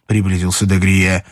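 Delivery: plain reading voice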